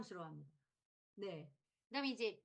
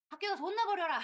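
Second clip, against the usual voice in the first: fed-up snap; 8 semitones higher, 7 dB louder than usual. Acted. Fed-up parent snapping at child.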